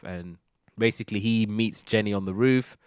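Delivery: ordinary talk, nothing marked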